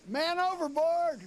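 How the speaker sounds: very high, loud, drawn-out cry; shout. Retold or imitated. Drunk bass player toppling off the riverboat, very high loud cry. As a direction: holler it like a man going overboard. Drunk bassist falls off boat.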